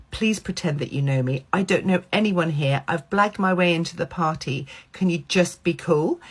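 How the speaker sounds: usual speaking voice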